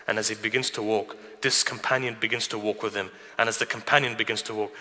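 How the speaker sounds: ordinary talk, nothing marked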